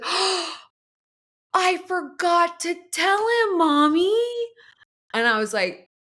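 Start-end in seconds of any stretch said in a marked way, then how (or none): 0.0-4.1 put-on high, slow, stretched-out little-girl wail; gasped dismay in a mimicked kid voice; imitating upset child. Young daughter confessing to mom, imitated upset child voice. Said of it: "she was so upset with herself".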